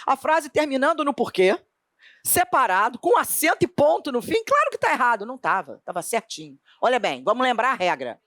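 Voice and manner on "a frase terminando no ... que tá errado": high, eager student voice, overconfident; an overexcited student, certain he is right; mock student. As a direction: play a cocky student who is sure he found the error. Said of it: "O aluno lia a letra A".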